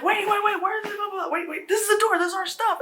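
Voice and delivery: high-pitched frantic panic; freaking out. Acted. Frightened girls realize the house has changed, freaking out. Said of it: "the girls are freaking out".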